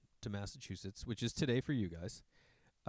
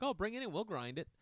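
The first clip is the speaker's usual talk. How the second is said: higher, sing-song barista offer; lightly mimicked barista, pitched up; friendly barista; 7 semitones higher than usual. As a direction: imitate a chirpy helpful barista. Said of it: "They're like".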